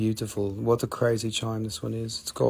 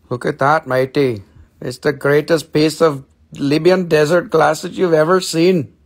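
loud, higher hammed-up boast; hammed-up matey; 4 semitones higher, 8 dB louder than usual. Seller showing off desert glass to viewers, loud hammed-up matey. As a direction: proclaim it like a cartoon sailor.

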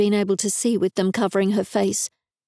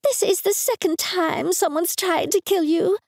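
high shrill mum voice; shrill screaming mother; 9 semitones higher than usual. A narrator's reading. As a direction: shriek it at her like an outraged mum. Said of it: "a shrill voice screamed".

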